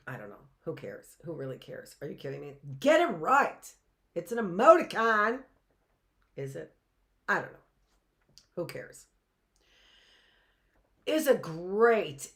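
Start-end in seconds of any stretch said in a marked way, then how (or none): 0.1-2.5 low, very soft aside; hushed, low self-talk; muttered aside. Acted. Mock argument with self. 2.8-5.4 higher, louder mock correction; hammed-up self-scolding. 6.4-9.1 low, very soft self-talk; hushed, dismissive mutter; muttered aside. Acted. Mock argument with self.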